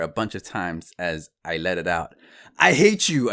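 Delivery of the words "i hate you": loud, high mock-furious yell; faked scream. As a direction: fake a furious outburst at an empty chair. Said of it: "I screamed at no one".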